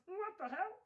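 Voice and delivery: usual speaking voice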